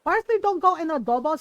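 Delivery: imitated mom, high and loud; mad mom. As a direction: insist it like an indignant mother. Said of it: "My mom got so mad".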